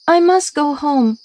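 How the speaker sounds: high-pitched princess voice; cartoon princess. A narrator's reading. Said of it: "said the princess".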